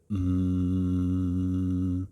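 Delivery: long, steady humming "mmm"; humming like a bee, drawn out evenly; closed-mouth M hum. Shown as a demonstration. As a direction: hold a resonant hum on one note, lips closed. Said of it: "c'est simplement un M".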